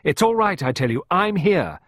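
high, loud, urgent comforting; insistent reassurance. A narrator's reading. Clarissa calming hysterical Pippa, insistent. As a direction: break through the hysteria with reassurance.

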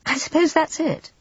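high, hesitant Jane admitting it; reluctant. Acted. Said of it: "said Jane reluctantly".

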